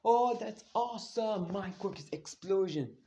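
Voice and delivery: high, slow, wide-eyed admiration; lightly voiced kid, gushing; impressed boy. Bakugo admiring Izumi's green fire, high and slow. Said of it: "he's like".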